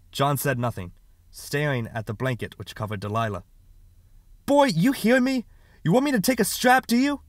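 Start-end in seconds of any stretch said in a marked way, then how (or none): 4.5-7.2 very high, loud, heated threat; angry threat. Acted. Father threatening silent son with a strap, furious. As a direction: explode at the child, pitch leaping up.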